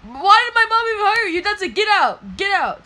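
high, loud outraged outburst; angry yelling. Acted. Boy throwing out a fake butler, angry shouting. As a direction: yell it as an indignant order.

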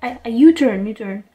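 loud mock exclamation; imitated urgent call. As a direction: blurt it out like a rushed driver. Driver calling out U-turn, retold.

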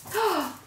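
high, sudden gasp; delighted gasp. Real, not acted. Guest spotting the big bathroom mirror, delighted gasp. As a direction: gasp at what you've just spotted.

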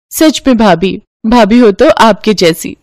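ordinary delivery, nothing marked

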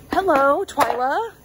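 loud, high, excited hello; delighted greeting. Real, not acted. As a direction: greet a long-sought treasure. Greets a doll she found.

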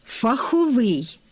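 Фаховий, stress on the last syllable: фахо-ВИЙ; slow, deliberate фаховий with the stress on ВИЙ; teacher's model of Фаховий with the stress on the final syllable; stress on the ending. Shown as a demonstration. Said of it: "варто в усіх формах наголошувати на закінченні".

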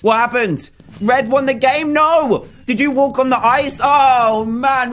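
high-pitched, incredulous dismay; dismayed disbelief. Real, not acted. Player learning the other team won, dismayed disbelief. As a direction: question it high, then groan it out. His team just lost the game.

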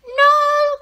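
very high loud squeak; high squeaky granny. A narrator's reading. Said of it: "called the old woman".

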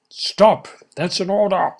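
loud high order; commanding shout. Acted. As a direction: bark the order. Commanding the natives.

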